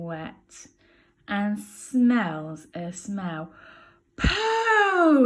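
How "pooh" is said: disgusted. Acted. Smelling a dirty nappy.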